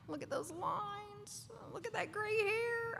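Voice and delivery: high, dismayed whine at the mirror; whiny mirror self-critic. Retold or imitated. Preacher acting out a listener groaning at the mirror, high and whiny. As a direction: fret at your own reflection in mock despair.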